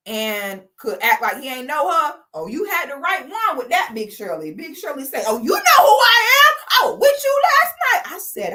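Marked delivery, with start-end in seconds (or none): 5.3-8.2 high, loud accusing cry; indignant woman's shout. Retold or imitated. Woman shouting at the man who denies knowing her, high and loud. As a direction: throw it at him like a scorned lover. Big Shirley confronts him.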